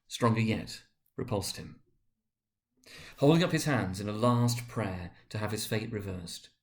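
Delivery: plain reading voice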